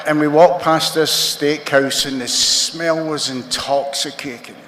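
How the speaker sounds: ordinary talk, nothing marked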